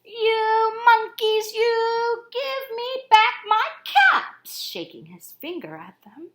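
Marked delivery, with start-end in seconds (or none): 0.1-4.6 high, loud, slow sing-song scolding; angry peddler. A narrator's reading. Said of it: "The peddler said".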